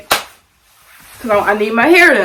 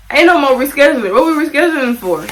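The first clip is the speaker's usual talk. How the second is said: higher, louder, fast indignant refusal; 5 semitones higher, 4 dB louder than usual. Retold or imitated. Refusing the stylist's reschedule, higher, louder and indignant. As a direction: push back hard, fed up. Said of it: "I was just like".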